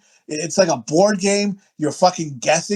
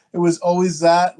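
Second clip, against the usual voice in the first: ordinary talk, nothing marked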